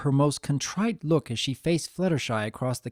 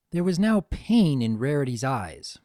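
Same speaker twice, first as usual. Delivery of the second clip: usual speaking voice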